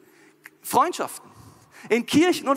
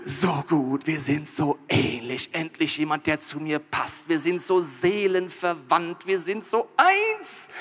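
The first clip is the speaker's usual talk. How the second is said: slow, low, dreamy swooning; hammed-up blissful infatuation; lovestruck gushing; 4 semitones lower, slower than usual. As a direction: sigh it out slowly like a smitten lover. Newly smitten couple gush to God.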